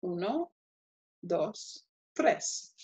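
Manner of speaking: soft, separated "uno, dos, tres" in Spanish; Spanish counting. Shown as a demonstration. Teacher counts off three consonants, softly in Spanish. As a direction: count "uno, dos, tres" quietly, pausing after each.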